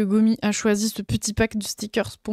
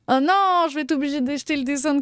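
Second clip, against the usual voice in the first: mock dismay; 4 semitones higher than usual. Streamer wailing she must buy another drawing. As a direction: wail it in fake despair.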